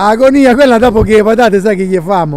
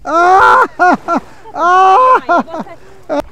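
shouting; 7 semitones higher, much slower than usual. Real, not acted. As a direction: yell it out across the water. Shouting while paddling out.

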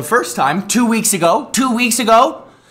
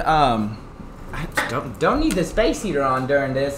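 usual speaking voice

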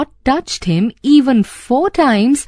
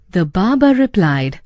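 ordinary delivery, nothing marked

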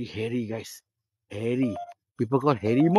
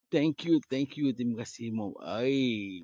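normal talking voice

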